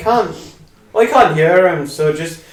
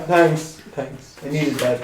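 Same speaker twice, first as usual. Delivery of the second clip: usual speaking voice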